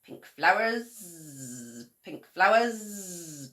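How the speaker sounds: slow, drawn-out buzzing bee. A narrator's reading. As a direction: buzz the words lazily. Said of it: "buzzed a big stripy bee".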